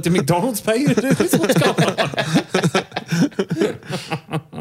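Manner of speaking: high, incredulous questioning; baffled disbelief. Retold or imitated. Retelling his reaction to the stranger, high incredulous voice. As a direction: ask it like you can't believe it. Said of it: "I was like".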